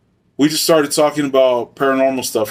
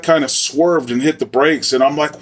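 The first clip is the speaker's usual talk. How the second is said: ordinary delivery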